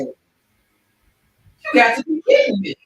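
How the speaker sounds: slow, loud disbelieving outburst; retold disbelief. Driver hearing his friend skip child support, slow loud disbelief. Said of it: "was like".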